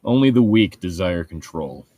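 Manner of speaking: normal talking voice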